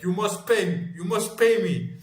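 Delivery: high, insistent mock demand; imitated foreign man. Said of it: "And he's like".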